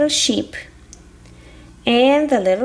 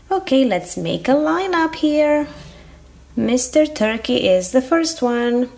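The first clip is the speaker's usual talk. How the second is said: usual speaking voice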